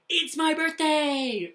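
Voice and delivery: high, loud, drawn-out cheer; excited yell. Shown as a demonstration. Teacher demonstrates an exclamation sentence, excited yelling. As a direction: yell it out with big feeling. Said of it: "like, yelling, more for really exciting".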